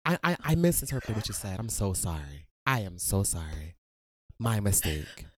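emphatic mock contrition, dropping low; exaggeratedly measured fake sorry; fake workplace apology. Shown as a demonstration. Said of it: "the workplace".

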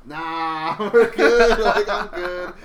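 high, amused brush-off; laughing refusal. Retold or imitated. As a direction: laugh through an awkward retold no-thanks. Laughing refusal of stranger's offered shot.

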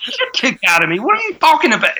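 mock outraged, high and laughing; incredulous retelling. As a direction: squeak out the disbelief through laughter. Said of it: "every single person said".

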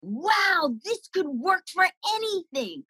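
put-on high, wide-eyed childish wonder; amazed little kid. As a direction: sound like a small child who just found magic.

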